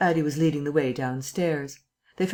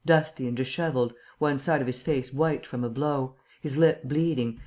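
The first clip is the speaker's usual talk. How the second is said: ordinary delivery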